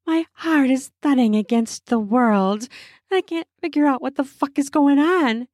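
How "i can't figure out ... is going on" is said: usual speaking voice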